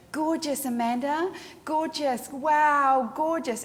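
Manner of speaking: high, empty gushing; hammed-up photographer cooing; gushing fake praise. Shown as a demonstration. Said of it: "It just sounds like crap".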